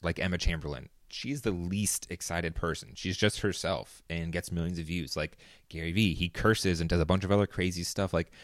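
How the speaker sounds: normal talking voice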